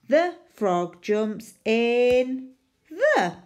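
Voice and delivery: slow, careful read-back. Shown as a demonstration. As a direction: drag each word as you write. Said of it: "reading back while writing".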